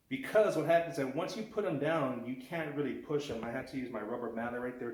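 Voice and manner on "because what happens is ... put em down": ordinary delivery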